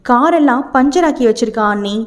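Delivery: ordinary delivery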